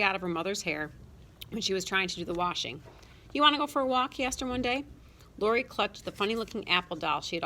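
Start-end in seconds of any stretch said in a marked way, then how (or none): 3.3-4.2 put-on high, coaxing older brother; coaxing big brother. A narrator's reading. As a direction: coax a little sister along. Coaxing little sister.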